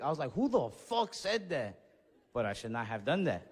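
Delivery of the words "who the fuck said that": slow, menacing who said that; turning on the guys, a slow tough challenge; mock angry challenge. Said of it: "I turned around so quick".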